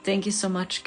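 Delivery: ordinary talk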